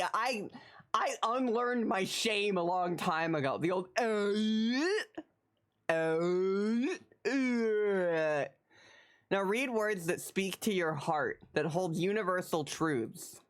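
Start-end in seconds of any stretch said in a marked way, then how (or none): 3.6-8.4 groan tube wail. Acted.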